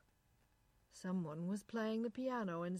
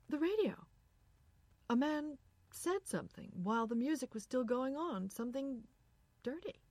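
alarmed; 6 semitones higher, 4 dB louder than usual. A narrator's reading. Irene alarmed by radio.

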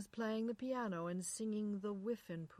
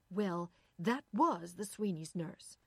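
loud, slow, emphatic retort; hotly insistent; 6 dB louder, slower than usual. A narrator's reading. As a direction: insist indignantly. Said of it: "Irene said hotly".

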